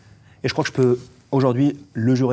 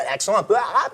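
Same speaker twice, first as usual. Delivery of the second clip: lightly higher, laughing Arabic accent; 9 semitones higher than usual. Shown as a demonstration. Agent demonstrates his Arabic accent to the host, amused. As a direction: slip into the accent with a chuckle. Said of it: "C'est exactement ça".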